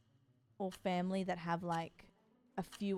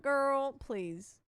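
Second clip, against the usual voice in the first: sassy; 4 semitones higher, 5 dB louder than usual. Retold or imitated. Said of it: "I'm like".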